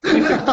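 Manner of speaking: high loud mock laugh of Exu. (risada alta de Exu)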